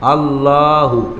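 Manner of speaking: আল্লাহু slowly, long la; drawn-out Allahu. Shown as a demonstration. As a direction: stretch the লা in আল্লাহু. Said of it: "আসে ছিল এমন". (আল্লাহু টেনে দীর্ঘ লা দিয়ে)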